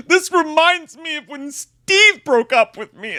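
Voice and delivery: high, slow mock wail; overdramatic heartbreak. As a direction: imitate a self-absorbed drama queen wailing. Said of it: "I'm being overdramatic about it".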